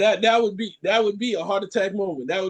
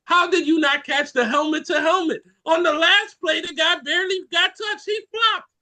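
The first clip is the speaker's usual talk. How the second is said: heated, rising, higher-pitched complaint; angry fan; 5 semitones higher than usual. Retold or imitated. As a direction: yell at the referee like an angry fan. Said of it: "the fans looking you in your eye and saying".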